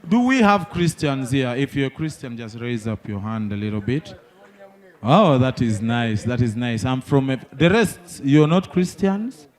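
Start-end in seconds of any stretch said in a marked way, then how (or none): none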